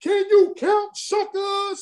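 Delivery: rally the gangs like Cyrus; high, rousing imitation of the gang leader; Cyrus's voice. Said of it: "He had a very".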